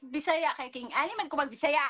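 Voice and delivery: punchy, high Bisaya delivery. Shown as a demonstration. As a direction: show how your voice lifts in Bisaya. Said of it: "Ba't iba boses pag Bisaya?".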